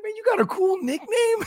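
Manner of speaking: high, far-off envious voice; mock jealous high voice; jealous movie character. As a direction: sound put out and envious. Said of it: "He's like".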